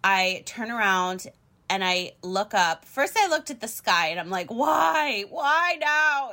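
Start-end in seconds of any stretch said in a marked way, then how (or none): 4.5-6.3 high, drawn-out plea to the heavens; hammed-up despair, pitched way up; mock despairing plea. Stranded driver pleading with the sky, high mock despair.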